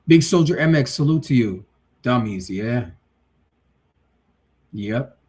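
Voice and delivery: ordinary talk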